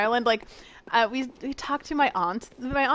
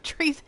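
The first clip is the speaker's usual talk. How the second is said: "Treason" higher, lilting up as a question; question mark; 6 semitones higher than usual. Shown as a demonstration. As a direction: pose "Treason" as a puzzled question. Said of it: "With a question mark?".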